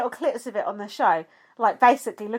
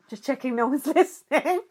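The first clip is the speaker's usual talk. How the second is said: laughing retelling; 3 semitones higher than usual. Retold or imitated. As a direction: quote broken by a laugh. Retold line broken by a laugh.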